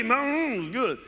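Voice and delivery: lightly Cajun, relishing; Cajun neighbor. Retold or imitated. Neighbor praises his turtle dinner, lightly Cajun relish. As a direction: savor it in a hint of Cajun. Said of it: "that was my neighbor".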